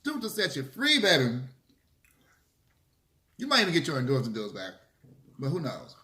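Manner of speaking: ordinary talk, nothing marked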